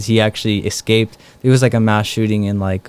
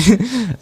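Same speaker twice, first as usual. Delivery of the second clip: high laugh; 12 semitones higher than usual. Retold or imitated. Laugh after the retold reply.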